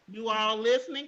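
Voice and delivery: ordinary talk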